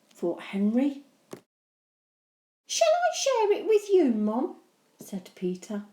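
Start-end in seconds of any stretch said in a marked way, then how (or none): 2.7-4.6 little brother. Acted. Peter sweet offer.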